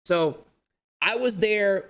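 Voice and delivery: ordinary delivery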